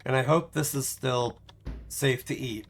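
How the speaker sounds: ordinary delivery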